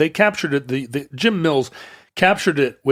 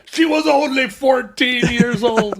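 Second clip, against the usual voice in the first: high, slow, sleazy excuse; lightly voiced creep pleading; creepy old man voice; 10 semitones higher than usual. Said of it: "He could have said".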